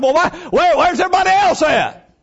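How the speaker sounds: high, loud, fed-up asking; exasperated complaint. Retold or imitated. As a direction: throw up your hands in disbelief. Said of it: "you're like".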